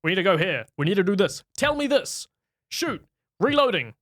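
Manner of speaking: high-pitched parody of a barking action star; mock movie commands. Host mocking The Rock's acting to co-host, in exaggerated commands. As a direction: imitate an action hero who only gives orders. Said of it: "He speaks only in commands".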